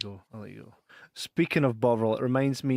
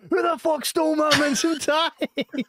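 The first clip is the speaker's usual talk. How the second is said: high, slow, drawn-out furious yell; outraged yell; 4 dB louder than usual. Retold or imitated. Colleague yelling across the call centre for his stolen lunch, high slow outrage. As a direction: bellow it across the office. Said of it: "all you heard through the call centre was".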